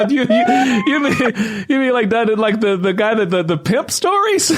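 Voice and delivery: laughing through the words in disbelief; gasping out the question mid-laugh; high, laughing disbelief. Real, not acted.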